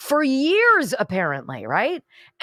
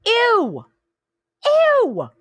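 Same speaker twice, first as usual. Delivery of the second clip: high squealing ew of disgust, much higher and louder; 12 semitones higher, 5 dB louder than usual. Real, not acted. Host reacts to the out-cheat advice with a squealing ew. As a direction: recoil in loud, high disgust.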